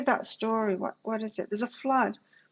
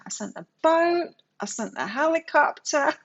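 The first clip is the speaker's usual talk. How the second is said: higher, louder pointed reply; exasperated God; 5 semitones higher, 4 dB louder than usual. Retold or imitated. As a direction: hammer home the obvious to him. God answering the drowned man.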